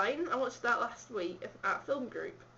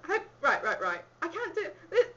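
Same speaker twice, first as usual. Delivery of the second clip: laughing annoyance; 5 semitones higher, 4 dB louder than usual. Real, not acted. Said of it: "This is irritating me".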